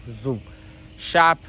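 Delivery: ordinary talk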